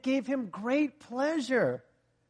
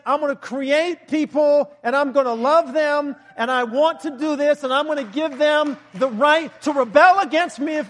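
loud, forceful preaching of God's words; preaching at full force; 3 semitones higher, 6 dB louder than usual. Acted. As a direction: proclaim it to the whole room. Preacher voicing God at force.

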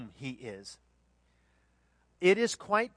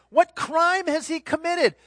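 loud, high demanding question; raised voice as Pilate confronting the crowd; 11 semitones higher, 9 dB louder than usual. Acted. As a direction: press the mob for an answer.

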